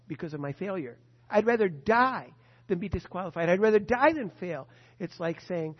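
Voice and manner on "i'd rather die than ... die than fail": fervent vow. Acted. Preacher's climactic vow.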